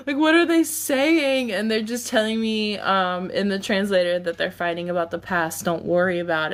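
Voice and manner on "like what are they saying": high, loud stunned cry; shocked. Retold or imitated. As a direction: gasp it out in disbelief. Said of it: "I'm just standing there like".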